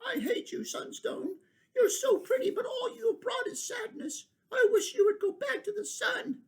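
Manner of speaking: put-on high, small child's voice pleading with the stone; a little kin sobbing out its grief; child voice, high and tearful; crying child voice. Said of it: "softly cried".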